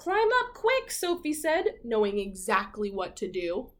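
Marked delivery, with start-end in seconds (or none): 0.0-0.9 much higher, quick call to climb aboard; high bird voice urging hurry; urgent seagull. Acted. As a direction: squawk it out as Sophie the seagull. Seagull calls to Jack.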